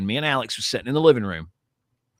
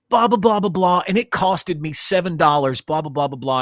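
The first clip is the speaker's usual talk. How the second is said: high, loud mock of a babbling influencer; imitating a dumb influencer kid, high and loud; mocking YouTube kid; 6 semitones higher, 6 dB louder than usual. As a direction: parody the kid's chatter, way up high. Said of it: "and he goes".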